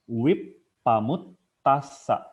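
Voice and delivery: Wipamut tasa drawn out slowly, each syllable separate; slow deliberate Pali syllables; slow clear syllables. Shown as a demonstration. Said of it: "kita harus clear ngucapinnya".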